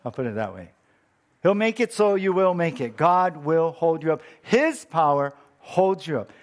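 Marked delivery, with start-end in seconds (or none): 1.4-6.3 full-force preaching. Acted. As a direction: preach it out to the whole church. Preaching repeated promise.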